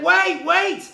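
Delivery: high, loud shout after someone leaving; calling out. Retold or imitated. Little boy running after the departing father, shouting high and loud. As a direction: yell to stop the rider.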